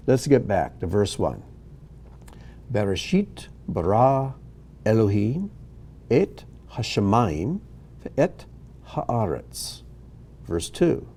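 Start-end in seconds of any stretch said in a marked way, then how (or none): 2.7-9.8 word by word, with pauses between; measured Hebrew reading. Shown as a demonstration. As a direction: read it out carefully for learners to follow. Reads Hebrew verses aloud slowly.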